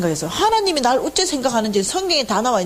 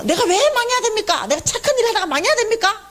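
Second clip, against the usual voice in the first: very high-pitched, indignant protest; railing at God; 11 semitones higher than usual. Retold or imitated. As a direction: retell it as an outraged plea, way up high. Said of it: "이러면서 하나님한테 난리가 난 거예요". (난리 치듯 아주 높은 목소리로 따져 묻기)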